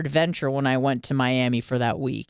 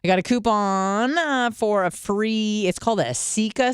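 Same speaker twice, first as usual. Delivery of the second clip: higher, brighter mock-thrilled "I got a coupon"; mock excited; 6 semitones higher than usual. Shown as a demonstration. Telling listeners about her coupon, hammed-up excitement. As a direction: play up the coupon like it's big news. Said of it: "should tell you how excited I am".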